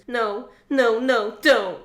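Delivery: high, loud desperate no's; shout. Acted. Blakely shouts in denial at dying Riot. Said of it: "I shout".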